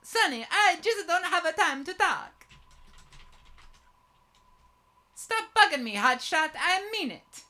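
hammed-up Italian accent; heavy Italian accent. Officer brushing off the detective, heavy Italian accent. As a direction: play an irritated officer in broad mock-Italian. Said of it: "In his heavy Italian accent".

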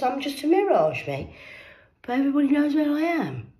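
high, loud triumphant rant; excited gloating. Real, not acted. Gloating at the doctor, high and loud. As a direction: crow over them.

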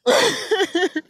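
real high giggling laugh; high cackle. Real, not acted. Laughing at the boyfriend mockery, high cackle. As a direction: cackle after your own insult.

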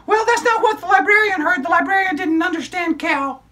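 put-on high, bright child puppet storyteller; squeaky kid character excitedly telling a story; high-pitched puppet girl narrating.

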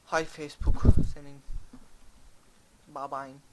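loud exasperated curse; angry outburst. Real, not acted. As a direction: blurt out in annoyance. Cursing sudden noise.

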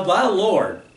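"the" said as a long, emphatic THE; slow down and lean on "the" before Lord; stress on THE. Shown as a demonstration. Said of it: "Definite article, the".

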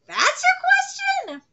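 very high, drawn-out incredulous question; acted incredulity. Villain answering the hero's timid question, incredulous. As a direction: squeal it in mock disbelief.

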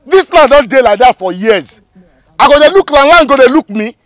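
high, loud indignant protest; angry argument. Acted. Land seller hotly defending the sale to the buyer, indignant. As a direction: argue back hotly, pitched well up.

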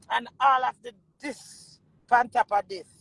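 ordinary delivery

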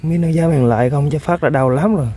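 normal talking voice

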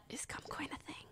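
hushed whisper, almost no voice. Real, not acted. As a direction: whisper it like a bad word. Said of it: "I don't know why I have to whisper that word".

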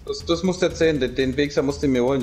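normal talking voice

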